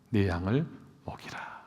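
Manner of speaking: hushed whisper of Jesus; soft whispered blessing. Acted. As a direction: breathe it like a secret. Jesus's tender words to Peter.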